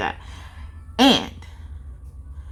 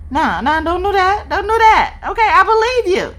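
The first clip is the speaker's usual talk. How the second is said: mock scared; 10 semitones higher, 3 dB louder than usual. Pleading with moving statue.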